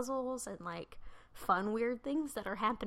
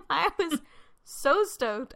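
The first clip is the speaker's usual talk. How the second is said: laughing; 7 semitones higher, 8 dB louder, slower than usual. Real, not acted. Laughing while recalling excitement.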